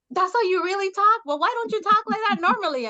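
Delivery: high-pitched quizzing, rising; curious coworkers. Retold or imitated. Coworkers hearing her on a phone call, astonished. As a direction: imitate nosy, astonished colleagues. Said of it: "'cause they wanna know".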